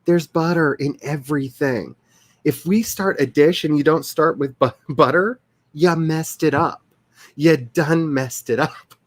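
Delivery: slow drawled Southern accent; slight Southern drawl, unhurried. Real, not acted. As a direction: lean into the Southern accent, slowly. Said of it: "my accidental accent".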